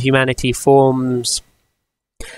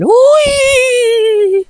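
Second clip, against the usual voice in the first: squeaky high ooh; mock high ooh; 5 dB louder than usual. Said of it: "they went".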